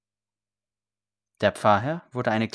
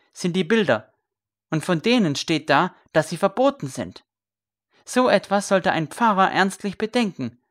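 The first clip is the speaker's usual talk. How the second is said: louder, higher, rising rhetorical questions; indignant sermon; 7 semitones higher, 6 dB louder than usual. A narrator's reading. Scribe lecturing the priest on scripture, indignant. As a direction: rail against the church's finery.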